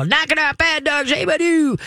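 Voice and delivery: high mock yell at the dog; hammed-up angry owner; mock screaming owner. Shown as a demonstration. Trainer imitating a screaming owner scolding the dog. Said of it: "if you start screaming".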